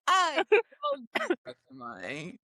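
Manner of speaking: high, slow, laughing through the words; laughing quote. Retold or imitated. Quoting while cracking up.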